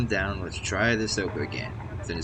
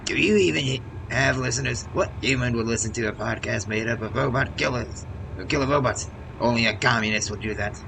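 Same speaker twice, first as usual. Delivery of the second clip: loud, scornful robot rant; loud anti-communist robot tirade; angry robot rant; 5 semitones higher, 6 dB louder than usual. Acted. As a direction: bark at the others like a paranoid robot. Nikolai robot ranting, voiced.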